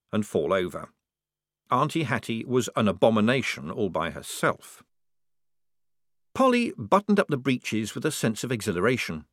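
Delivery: ordinary delivery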